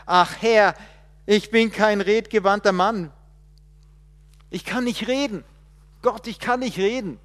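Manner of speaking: loud, high pleading cry; desperate protest. Acted. Moses begging God, loud and high. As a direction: cry out that you can't do it.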